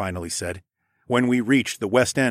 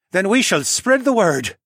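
raised, louder pledge; solemn vow; 10 semitones higher, 7 dB louder than usual. A narrator's reading. Villager vows with fist over chest, loud and earnest. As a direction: vow it with ceremony. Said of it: "Leo vowed".

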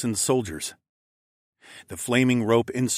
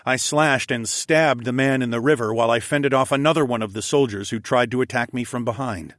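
ordinary delivery

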